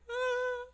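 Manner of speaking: high, loud mock wail; imitated burst of crying; imitated sob. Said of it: "sobbing, crying".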